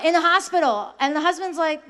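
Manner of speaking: ordinary talk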